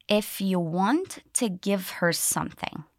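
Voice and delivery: slow, careful, each linked sound clear; slowly. Shown as a demonstration. Teacher drilling linking sounds for learners, slow. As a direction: stretch it out word by word. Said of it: "Let's do it once slowly".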